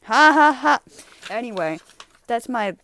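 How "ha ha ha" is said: loud, high, hammed-up ha ha ha; a sarcastic laugh that shows it wasn't funny; over-the-top mock laughter; comedic fake laugh. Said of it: "my comedic laugh to symbolize".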